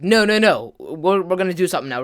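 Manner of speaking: loud mock "no way, we're not stopping"; insistent protest. Friend determined to keep everyone up.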